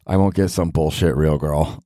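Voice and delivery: normal talking voice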